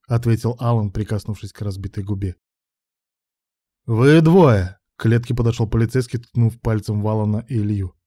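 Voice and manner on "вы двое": loud, raised policeman's call; commanding shout. A narrator's reading. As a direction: call them out with authority. Policeman orders prisoners out.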